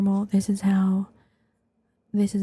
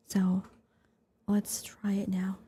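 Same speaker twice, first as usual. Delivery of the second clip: normal talking voice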